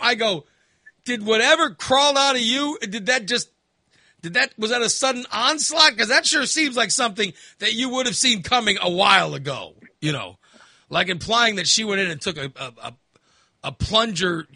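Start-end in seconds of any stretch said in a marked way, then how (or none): 1.1-9.8 incredulous scolding. Retold or imitated. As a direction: grill her in disbelief. Accusing mother.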